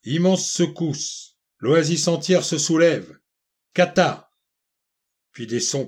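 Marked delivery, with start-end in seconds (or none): 0.0-4.3 high, spaced-out alarm bulletin; clamoring announcement. A narrator's reading. Great receiver announcing a disaster to the waiting crowd, clamoring. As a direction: call it out like an emergency broadcast. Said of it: "la conque du grand planétaire clama".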